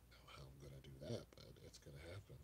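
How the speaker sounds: fast whisper under the breath; hushed quick aside. Real, not acted. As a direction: mutter it to yourself, barely voiced. Aside to himself about work.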